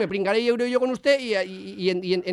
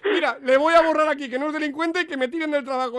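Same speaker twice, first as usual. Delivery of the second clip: higher, hammed-up weepy voice; fake tearful; 5 semitones higher than usual. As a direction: melodramatic teary self-sacrifice. Prankster hams tearful sacrifice. (sollozo de broma)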